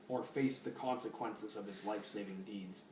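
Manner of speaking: usual speaking voice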